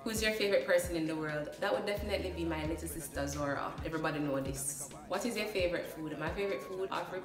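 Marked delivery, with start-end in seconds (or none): none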